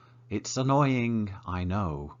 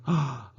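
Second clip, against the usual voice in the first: mock gasp; 5 semitones higher than usual. Mock shock at the practice sheet.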